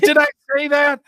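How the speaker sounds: high, startled question; surprised friend. Retold or imitated. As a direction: sculptor friend startled by his own old words, high and incredulous. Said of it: "He goes".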